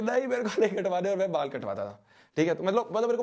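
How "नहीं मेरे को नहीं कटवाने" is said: higher, whiny imitation of a crying little boy; a touch of a child's wail, pleading; mock crying child. Little boy held down for a haircut, mock crying plea. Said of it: "मैं ऐसे रो रहा हूँ".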